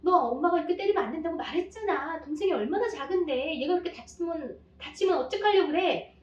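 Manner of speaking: mock mom scolding in front of the baby. Shown as a demonstration. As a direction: pour out a scolding at the child. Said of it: "첫째를 막 다그치고 혼내는 거예요".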